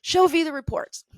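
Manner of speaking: high, loud, eager imitation; excited. Supervisor eager to show off the reports, excited. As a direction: mimic an excited boss, pitch jumping up. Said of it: "And she's excited".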